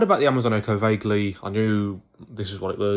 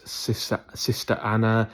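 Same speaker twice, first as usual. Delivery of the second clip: ordinary talk, nothing marked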